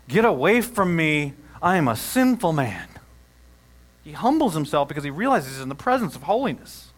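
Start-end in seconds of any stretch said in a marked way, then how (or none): 0.1-2.9 humbled plea. Retold or imitated. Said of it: "You know what Peter says?".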